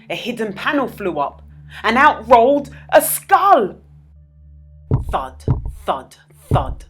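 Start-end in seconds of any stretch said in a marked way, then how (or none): none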